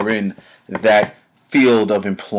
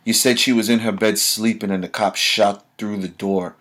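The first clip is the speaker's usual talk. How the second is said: ordinary delivery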